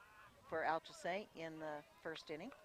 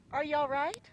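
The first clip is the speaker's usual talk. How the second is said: concerned call; 7 semitones higher, 11 dB louder than usual. Real, not acted. Calling to the hit spectator.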